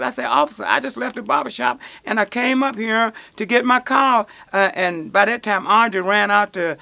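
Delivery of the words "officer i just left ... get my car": higher, strained protest to the officer; pleading with the officer, voice rising. Retold or imitated. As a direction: plead your case, voice climbing.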